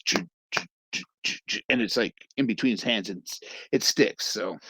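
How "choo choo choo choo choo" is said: sticky choo noise. Retold or imitated. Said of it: "it goes".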